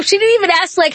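usual speaking voice

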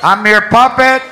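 loud, slow dedication over store intercom; PA shout-out. Acted. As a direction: dedicate a song on the PA, drawn out. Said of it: "they do shout-outs".

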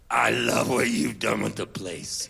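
low, slow imitation of a singer. Singer looking inside the messy van, imitated low and slow. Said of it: "and he goes".

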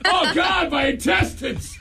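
high, strained mock cry of pain; hammed-up agony. Host hamming up a listener's stomach pain, mock agonized wail. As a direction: wail it like your gut is exploding.

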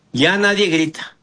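ordinary talk

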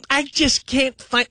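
put-on high, slow, lost old parent voice; comparing Dabo to helpless parents, high and slow. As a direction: whine that you can't find it. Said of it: "that's him. That's Dabo".

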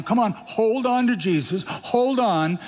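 high-pitched, pleading coaxing; mock urging. Acts out anxious urging to cling.

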